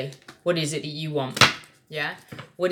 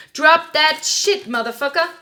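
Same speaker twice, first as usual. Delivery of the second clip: hammed-up command; 10 semitones higher, 6 dB louder than usual. Reader urging Aries to let go, hammed-up command. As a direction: stretch it out in a put-on voice.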